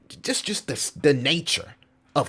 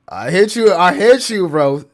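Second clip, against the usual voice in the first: hyped shout; 6 semitones higher, 10 dB louder than usual. Real, not acted. Hyped to viewer.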